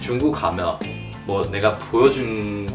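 ordinary talk

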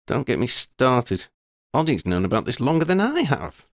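put-on girl's voice, amused then serious; Lydia, wry schoolgirl. A narrator's reading. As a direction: tease her friend, then own up frankly. Lydia exasperated.

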